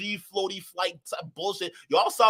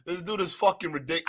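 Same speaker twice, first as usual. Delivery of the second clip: normal talking voice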